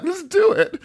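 very high, laughing eager king; boy king thrilled at the duel; gleeful. Retold or imitated. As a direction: squeal it with delight. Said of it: "he was so happy".

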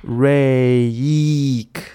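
RAKE hugely stretched into RAY-EEK; exaggerated long A. Shown as a demonstration. Teacher teaching a trick, exaggerated RAY-EEK. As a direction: slowly drag RAKE out, inserting a long ee before the k. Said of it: "把中文一乌吁的一放进去".

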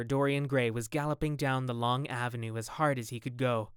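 ordinary delivery

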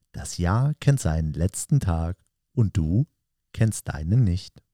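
slow, low, soft poetry reading; poem recitation. Acted. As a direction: recite it slowly and softly, pausing after each short phrase.

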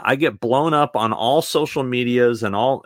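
ordinary delivery

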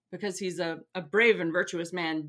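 usual speaking voice

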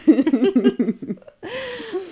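a high, falling burst of laughter. Real, not acted. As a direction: laugh at your own dark joke. Laughing after dark joke.

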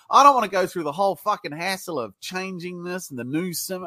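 normal talking voice

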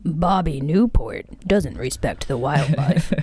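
hushed, slow ad-announcer voice; mock campaign ad. Teasing friend with a mock campaign ad, hushed. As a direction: whisper it like a smear ad.

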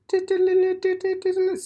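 high, slow excited squeal; drawn-out high delighted exclamation; gleeful squeal. Real, not acted. Delighted nostalgia.

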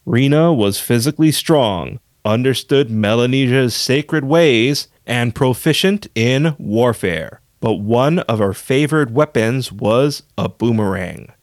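upbeat show announcer; bright radio host teasing what's next; podcast host. Acted. Host intro and teasers.

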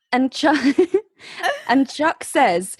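ordinary talk

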